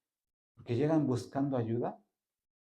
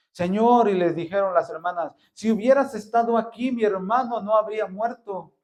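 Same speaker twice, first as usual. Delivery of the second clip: high, loud anguished plea; mourning sisters pleading with Jesus; grieving sisters; 7 semitones higher, 9 dB louder than usual. Acted. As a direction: cry out the loss to the Lord.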